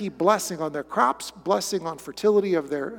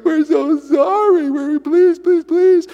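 high, loud, slow begging; mock pleading; 8 semitones higher, 8 dB louder, slower than usual. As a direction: beg for mercy, hammed up. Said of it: "They're like".

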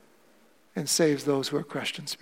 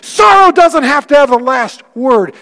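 louder and higher, slowing on the key lines; impassioned preaching; 9 semitones higher, 15 dB louder than usual. Real, not acted. Pastor assuring his grieving congregation they are not alone, fervent preaching. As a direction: pour comfort over the grieving, full voice.

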